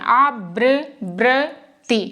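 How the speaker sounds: आवृ-ब्र-ति broken into slow separate syllables. Shown as a demonstration. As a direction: sound it out very slowly for students. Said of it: "ये वाला जो रहता है यहाँ पर मैं बता रही हूँ".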